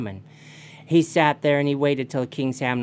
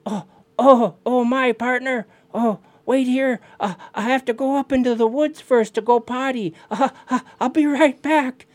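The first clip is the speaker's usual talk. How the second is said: high, loud, flustered stalling; loud, high-pitched excuse-making; excited Raven; 10 semitones higher, 7 dB louder than usual. Acted. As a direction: blurt it out, wildly excited and flustered. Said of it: "Raven was so excited".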